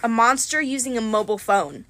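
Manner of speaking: ordinary delivery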